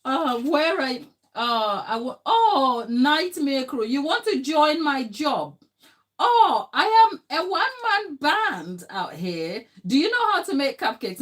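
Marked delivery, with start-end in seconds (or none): 2.3-11.2 high, loud, bubbling over with excitement; excited chat greeting. Real, not acted. Host greets a viewer in the live chat, excitedly high and loud. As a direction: squeal a delighted hello to the viewer.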